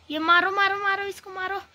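high-pitched, urgent call to attack; urgent game call. Real, not acted. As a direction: egg your team on mid-fight. Urgent call during a firefight. (जोश भरी ऊँची आवाज़)

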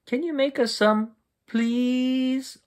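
squeaky high pleading; high begging child. A narrator's reading. Froggy begging his mother for popcorn, high pleading. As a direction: wheedle for popcorn.

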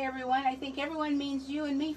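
ordinary delivery

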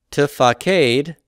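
"t, fa, cade" slow, syllable by syllable; sounding out slowly. Shown as a demonstration. Teacher spelling out the form, slow syllables. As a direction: stretch out each syllable of "t, fa, cade".

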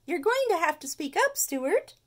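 high, loud schoolteacher voice; teacher voice. Acted. Miss Finnegan quizzing the geography class, high and loud.

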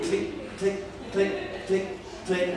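soft clicking tick of the chain lift; ticking climb. Retold or imitated. Coaster climbing sound effect.

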